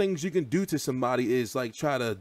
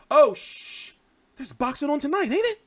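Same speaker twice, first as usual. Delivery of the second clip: higher, sudden hush then excited question; sharp hush, then a higher, eager question; surprised; 12 semitones higher, 4 dB louder than usual. Real, not acted. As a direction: hush someone, suddenly remembering. Hushing, surprised about boxing.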